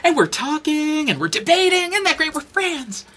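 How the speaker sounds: mocking editor voice. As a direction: parody her fake cheerfulness in a high voice. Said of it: "she was like".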